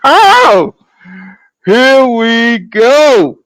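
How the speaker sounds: long, slow, loud "here we go"; drawn-out "Oh, here we go"; hype intro. Real, not acted. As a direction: stretch it out like a hype man opening the show. Opening his live show, hyping.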